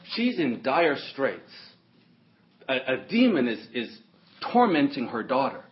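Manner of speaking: ordinary talk, nothing marked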